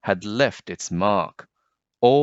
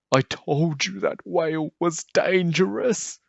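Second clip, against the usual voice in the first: mock whisper; 9 semitones higher than usual. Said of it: "whispered the fish with the jagged fins".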